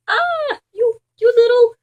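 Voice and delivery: high, loud, fast sputtering yell; angry yell. Acted. Neighbour yelling at the dog in his yard, angry sputter. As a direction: splutter at the dog. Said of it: "Mister Mehta yelled".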